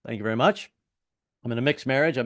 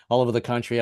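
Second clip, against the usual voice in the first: usual speaking voice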